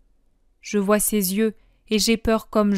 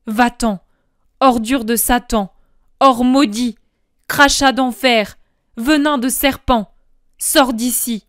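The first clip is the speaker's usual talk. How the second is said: louder, breathless furious curse; choking fury; 2 semitones higher, 6 dB louder than usual. Acted. Jesus cursing Judas's stolen money, choking with fury. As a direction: spit the curses out, barely contained. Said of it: "Il dit en suffoquant".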